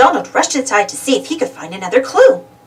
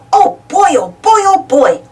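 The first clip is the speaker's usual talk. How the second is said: louder, higher duck voice, breathless joy; excited gasp; 9 semitones higher, 5 dB louder than usual. A narrator's reading. Donald overhearing talk of his present, excited gasps. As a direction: gasp it out like he can't wait. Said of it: "Donald gasped".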